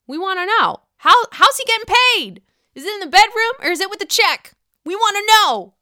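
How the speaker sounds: high, loud rallying cry; playful loud demand. Real, not acted. Podcaster demanding gossip about pay, loud high playful shout. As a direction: holler it like a demanding crowd.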